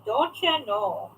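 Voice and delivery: slow, drawn-out Minnesota vowels; mock Minnesota accent. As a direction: put on a Minnesotan lilt, stretched out. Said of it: "somebody who lives in Minnesota".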